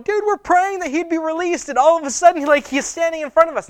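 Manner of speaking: very high, loud excited outburst; Rhoda freaking out. Acted. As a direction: act out a girl freaking out with joy. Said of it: "she's freaking out".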